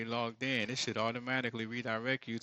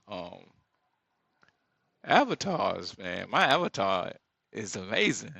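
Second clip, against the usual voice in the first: normal talking voice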